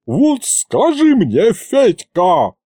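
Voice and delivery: put-on old aunt hollering at Fedya; wailing yell. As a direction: cry it out at him, finger pointed. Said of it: "завопила вдруг тётка Ягута".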